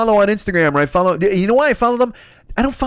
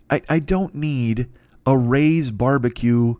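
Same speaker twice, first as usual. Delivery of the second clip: usual speaking voice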